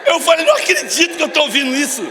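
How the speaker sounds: loud, laughing disbelief; real laughing shout. Real, not acted. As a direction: laugh through the words in shock. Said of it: "eu comecei a rir".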